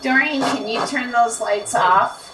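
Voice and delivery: usual speaking voice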